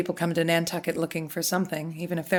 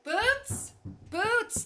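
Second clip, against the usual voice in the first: pitched-up call across the house; 5 dB quieter than usual. Acted. Innkeeper calling her daughter away from the guest. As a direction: call the girl back sharply.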